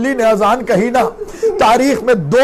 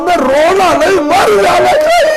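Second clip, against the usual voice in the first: the pitch leaps up into a high cry; wailing climax; 8 semitones higher, 3 dB louder than usual. Acted. Reciter urging mourners to grieve, a high wailing cry. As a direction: break into a keening wail on the last words.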